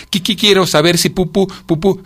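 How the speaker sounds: high voice tripping on first syllables; shivering stammer. A narrator's reading. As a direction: stutter through a timid request. Cicada stuttering a request.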